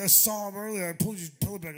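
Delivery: mock triumphant bandmate; smug brag. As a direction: crow about it. [name] brags.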